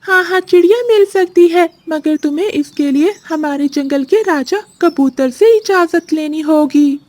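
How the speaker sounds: chirpy parrot. Acted. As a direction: play the cheerful jungle parrot. Parrot character speaks.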